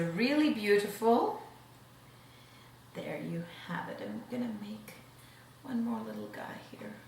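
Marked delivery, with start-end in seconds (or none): 2.9-4.0 unvoiced soft whisper. Shown as a demonstration. Whispered repeat while plating. 4.0-6.9 whispering. Real, not acted. Whispering while plating the beets.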